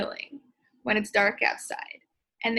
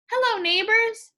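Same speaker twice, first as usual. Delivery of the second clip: much higher and louder, bright welcome; cheery hostess greeting; 10 semitones higher, 5 dB louder than usual. Shown as a demonstration. Hostess Missus Perkins greeting neighbours, high and welcoming. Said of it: "different people are talking".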